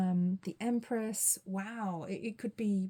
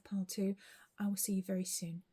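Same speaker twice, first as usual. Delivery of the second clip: ordinary delivery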